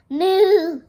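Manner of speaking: high, loud cow moo; storybook cow voice; cow's moo. Acted. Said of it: "said the cow".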